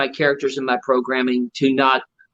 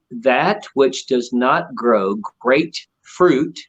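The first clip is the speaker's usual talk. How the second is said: solemn scripture; slower than usual. Retold or imitated. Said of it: "And he said".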